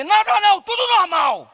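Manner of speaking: high, loud shouted all-is-fine; family hollering to the neighbour that nothing is wrong; shouted reassurance. Retold or imitated. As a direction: yell that everything is normal.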